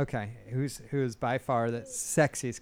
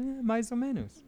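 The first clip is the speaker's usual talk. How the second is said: teasing shrug; 9 semitones higher, 4 dB quieter than usual. Retold or imitated. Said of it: "And I said".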